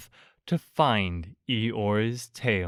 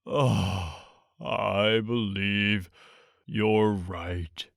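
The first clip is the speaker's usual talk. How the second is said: low, slow, sighing and glum; sad sigh; 4 semitones lower, 4 dB quieter, much slower than usual. A narrator's reading. Eeyore accepting his tail is gone, sad sigh. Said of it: "with a long, sad sigh".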